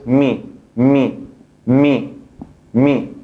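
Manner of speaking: mi repeated, short i with kasra; Arabic syllable mi. Shown as a demonstration. Teacher repeating kasra syllable for class, even drilled mi. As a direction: drill mi four times evenly. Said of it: "se pronuncia mi".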